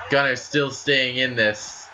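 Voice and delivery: normal talking voice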